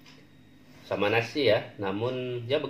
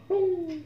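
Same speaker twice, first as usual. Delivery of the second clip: high plop sound. Acted. Dropping food in bowl.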